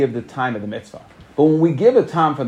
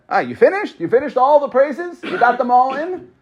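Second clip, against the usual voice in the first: much higher, rising incredulous questions; pointed mock questioning; 8 semitones higher than usual. Rav Chanina to the man who piled up praises, mock questioning. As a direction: needle him with raised-pitch questions.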